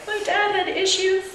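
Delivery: put-on high-pitched needy girl act; put-on high little voice; worst Bond girl. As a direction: confess in a high girlish voice. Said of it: "the girl who's like".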